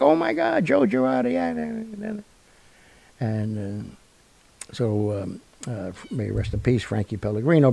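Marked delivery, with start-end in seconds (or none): 0.0-2.2 high, starstruck gushing; mock excited fans gasping over a celebrity; excited crowd. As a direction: squeal like a starstruck fan. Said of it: "Everybody's like".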